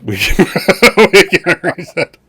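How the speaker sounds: repeated through laughter, high and loud; laughing repeat. Retold or imitated. GM repeats the player's threat while laughing. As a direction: say it again while cracking up. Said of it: "laughs repeating".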